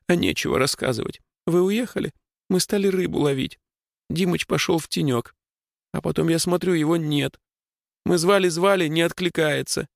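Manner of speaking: put-on high, tearful little girl. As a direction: tell it like a shaken little girl. Little girl tells what happened.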